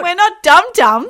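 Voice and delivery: high, loud indignant protest. Real, not acted. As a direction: protest loudly, half joking. Outrage at charity shop prices.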